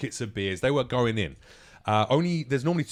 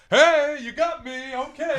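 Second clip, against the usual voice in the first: high, airy mock concession; mock gracious heckler; 5 dB louder, slower than usual. Hypothetical hecklers admit defeat, high and airy. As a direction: play the heckler conceding sweetly. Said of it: "they were not gonna go".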